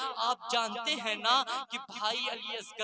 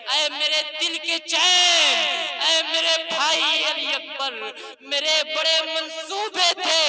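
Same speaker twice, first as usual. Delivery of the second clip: wailing lament; 4 semitones higher, 8 dB louder than usual. Acted. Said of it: "حضرت بی بی Sughra کہتی ہے".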